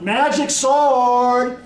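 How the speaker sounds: loud, drawn-out boast; hollering. A narrator's reading. Sword points at itself to show off, hollering slowly. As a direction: holler it, stretching the words right out. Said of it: "pointing back at itself at Howland".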